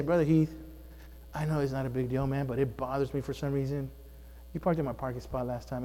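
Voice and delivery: calm him down with a soft, even voice; a soft, reasonable word to the deacon; calm demeanor. Shown as a demonstration. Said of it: "come to him with a more calm spirit, demeanor".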